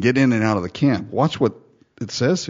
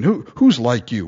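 ordinary talk, nothing marked

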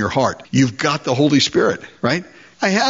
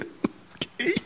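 whispered, much softer reluctant okay; timid whisper; 12 dB quieter than usual. Acted. Believer giving in to the Lord, timid whispered okay. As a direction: barely breathe the word in resignation.

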